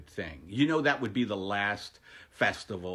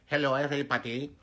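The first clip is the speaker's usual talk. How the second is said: quick, clumsy Indian accent; badly faked Indian accent, rattled off fast; 4 semitones higher, much faster than usual. Shown as a demonstration. Comedian shows off a favorite accent, bad Indian accent. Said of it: "one of my favorite accents to do is Indian".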